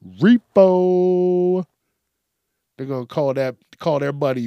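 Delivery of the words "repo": loud, high announcing of Repo; loud mock announcement of Repo; dramatic Repo. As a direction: call the name like a menacing arrival. Naming the dreaded Repo.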